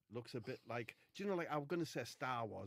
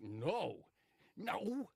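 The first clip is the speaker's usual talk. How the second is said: rising, louder no; shouted no; 7 semitones higher, 4 dB louder than usual. Retold or imitated. Wade refusing loudly.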